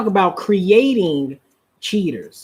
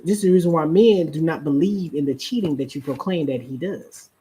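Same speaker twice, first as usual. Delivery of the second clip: normal talking voice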